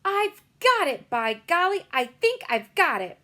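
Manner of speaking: put-on a girl's eureka shout; excited. A narrator's reading. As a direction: burst out with a sudden idea. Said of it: "in a voice filled with excitement".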